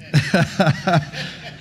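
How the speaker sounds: chuckling at his own story; a real laugh breaking into the telling. Real, not acted. Preacher amused by the joke he retells, laughing.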